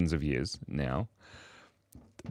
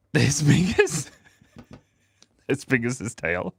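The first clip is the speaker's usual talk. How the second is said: words pushed out through laughter; cracking up; 4 semitones higher, 3 dB louder, slower than usual. Real, not acted. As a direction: present it while losing it laughing. Laughing at own drawing.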